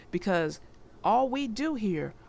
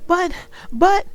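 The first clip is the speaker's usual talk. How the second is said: higher, louder stammering little turtle voice; child Franklin protesting; 8 semitones higher, 12 dB louder than usual. Acted. Franklin protesting to his parents at bedtime, high stammering child voice. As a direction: sputter like a small kid caught out of bed.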